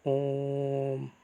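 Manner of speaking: ओम held long and said softly; soft drawn-out Om. Shown as a demonstration. Teacher demonstrates a pluta vowel: soft, long ओम. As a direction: hum ओम out slowly. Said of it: "अगर हम ओम का उच्चारण करके देखें".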